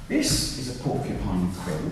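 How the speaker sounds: ordinary delivery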